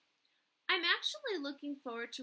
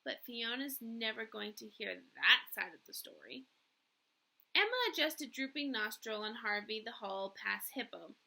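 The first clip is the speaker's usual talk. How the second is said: ordinary delivery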